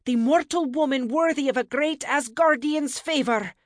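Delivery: high, loud, forceful incantation; ferocious. A narrator's reading. Goddess willing the mirror to show the woman, ferocious. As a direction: command it with fierce will. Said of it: "she thought ferociously".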